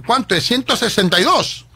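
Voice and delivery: loud, high back-and-forth over the bill; mock yelled exchange; retold shouting. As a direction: call it out for everyone to hear.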